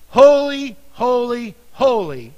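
loud ringing proclamation of holy; crying out. Acted. Seraphim crying to one another, loud ringing cry. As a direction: call out Holy like an angel across the heavens. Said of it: "one cried to another".

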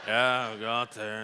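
put-on low, unenthusiastic teen agreement; imitating reluctant son. Son agreeing to help Dad, reluctant. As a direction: drag it out like a kid who'd rather not. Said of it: "You know, they're like".

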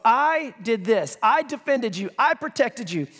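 "I" stretched and stressed at the head of each phrase; emphasis on I. Shown as a demonstration. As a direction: hit every "I" hard and hold it. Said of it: "I'm gonna emphasize the word "I".

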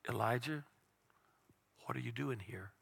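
hushed and gentle, barely above a breath; soft whisper of God. Acted. Preacher voicing God gently calling to Elijah, hushed. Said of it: "a soft whisper of a voice".

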